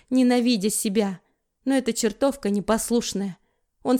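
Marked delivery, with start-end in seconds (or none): none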